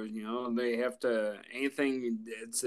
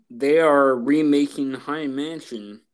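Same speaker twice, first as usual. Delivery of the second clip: loud announcement; 8 dB louder than usual. Real, not acted. Dramatic announcement.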